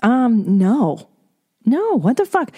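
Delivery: flat no, then a high outraged question; indignant refusal. Retold or imitated. Turning down a pay offer, indignant and high. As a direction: shoot it down in disbelief. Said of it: "as simple as going".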